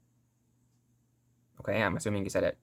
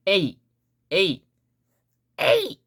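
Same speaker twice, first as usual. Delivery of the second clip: higher, punchy cry rising in pitch; anime battle cry; 6 semitones higher, much slower than usual. Shown as a demonstration. Teacher showing Japanese fighting cry, high and punchy. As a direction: call it out like a sword strike. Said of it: "that's the Japanese version of that".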